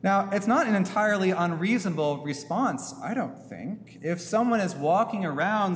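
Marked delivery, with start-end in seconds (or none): none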